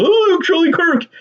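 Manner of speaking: high, whiny fake lament; mock the mourners with a fake sob voice; mock crying. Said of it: "crying because Charlie Kirk was killed".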